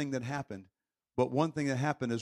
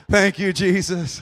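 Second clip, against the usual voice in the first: louder, slow grateful praise; emphatic thanks; 4 semitones higher, 9 dB louder, slower than usual. Retold or imitated. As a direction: draw out each word in relief.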